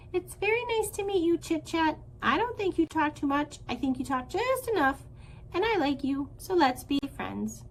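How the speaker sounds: high, chirpy small-bird greeting; gosling introduces himself to anyone, high and chirpy; little gosling voice. Acted. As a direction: greet everyone like a tiny gosling. Gosling voice in story.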